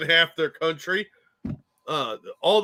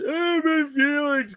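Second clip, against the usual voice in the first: slow, high whiny mockery; mocking whine; 10 semitones higher, slower than usual. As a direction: whine it like a crybaby.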